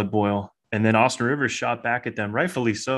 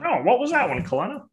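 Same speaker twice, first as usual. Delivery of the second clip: high, swooping put-on commentator voice; mock broadcaster banter; 12 semitones higher than usual. Mimicking Bob chatting to co-commentator.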